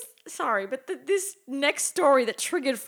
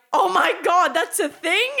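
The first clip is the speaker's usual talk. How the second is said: higher, louder astonished question; shocked disbelief; 6 semitones higher, 5 dB louder than usual. Retold or imitated. Client retells learning of back waxing, shocked. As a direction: blurt it, aghast at the news.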